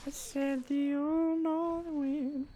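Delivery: very high, slow, soft eerie voice; drawn-out ghostly wail; old north wind voice. Pretend. Putting on eerie voice.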